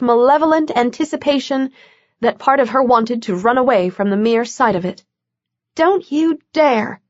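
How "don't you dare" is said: higher, slow stern order to herself; self-command. A narrator's reading. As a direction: order yourself firmly. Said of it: "she ordered herself".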